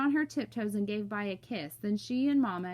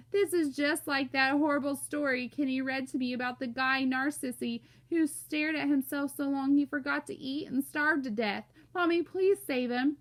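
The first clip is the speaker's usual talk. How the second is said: higher, distressed little sister's plea; mild mock blubber, ending in begging; 6 semitones higher, 4 dB louder than usual. A narrator's reading. Joey begs Mommy to save her brother, blubbering. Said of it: "Joey blubbered out".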